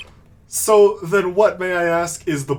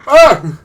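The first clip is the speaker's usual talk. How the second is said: pained yelp; 9 semitones higher, 12 dB louder than usual. Acted. Hit by whip.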